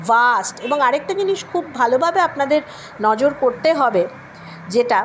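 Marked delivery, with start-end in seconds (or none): 0.1-0.6 ভাস্ট with a voiced v; teacher models ভাস্ট; v sound demo. Shown as a demonstration. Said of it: "ভা sound". (ভা দিয়ে ভাস্ট)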